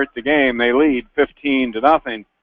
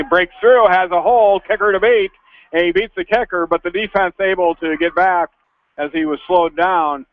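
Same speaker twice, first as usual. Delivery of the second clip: rising, higher, building call; excited play-by-play; 5 semitones higher than usual. Real, not acted. Live call of long kick return.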